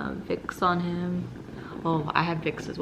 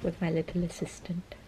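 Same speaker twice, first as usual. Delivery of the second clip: whispering; 5 dB quieter than usual. Real, not acted. Whispered aside.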